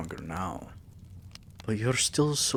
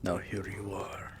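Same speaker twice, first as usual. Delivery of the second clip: ordinary talk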